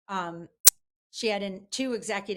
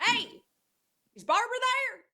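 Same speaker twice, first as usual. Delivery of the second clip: put-on Ross Perot Texan accent; 14 semitones higher, 5 dB louder than usual. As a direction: do Perot's Texas twang. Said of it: "with his accent".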